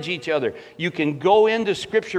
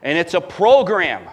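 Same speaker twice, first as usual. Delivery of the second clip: ordinary delivery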